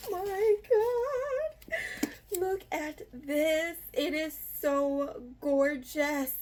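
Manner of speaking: high, slow, breathless gushing; awed squeal. Real, not acted. Vlogger pulling out the first book, slow high gush. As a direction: draw out the awe, high and slow.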